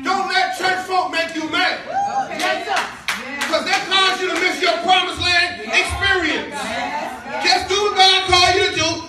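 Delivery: rising sermon exhortation; preaching at full force. Acted. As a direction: drive it home to the congregation. Preaching climax to congregation.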